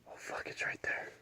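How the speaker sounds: breathy whisper, no voice. Real, not acted. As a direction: whisper so it won't fly off. Bird found, stays hidden.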